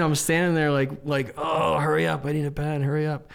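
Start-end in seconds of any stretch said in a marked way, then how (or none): 1.4-3.3 retold fretful urging; frantic impatience. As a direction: urge them along, mock desperate. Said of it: "I'm freaking out".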